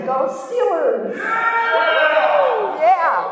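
loud, slow stadium cheer for the Steelers; rally the room like a fan in the stands; team cheer. Real, not acted. Cheering for her hometown team, loud and slow. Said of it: "cheering for home team".

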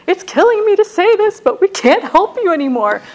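high, loud, pained plea; imitated anguished mother. Mom refusing to help her daughter, anguished imitation. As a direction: act out a mom pushed to her limit.

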